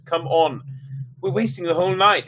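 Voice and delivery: put-on complaining boy.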